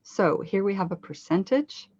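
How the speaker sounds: ordinary talk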